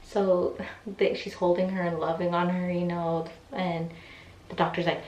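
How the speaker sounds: usual speaking voice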